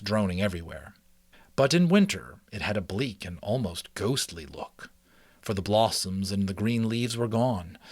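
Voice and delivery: steady, lively read-aloud narration; story narration. A narrator's reading. Reading a mouse adventure to listeners, storytelling voice. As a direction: read it like a chapter book to listeners. Reads chapter book aloud.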